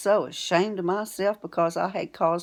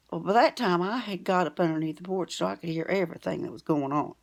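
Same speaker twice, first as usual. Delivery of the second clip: ordinary delivery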